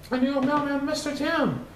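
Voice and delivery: surprised greeting. A narrator's reading. Narrator greets new mailman.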